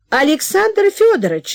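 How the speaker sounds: high, loud call from another room; mother calling out. Acted. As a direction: shout for him from afar. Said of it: "закричала вдруг мать". (мать громко зовёт из комнаты)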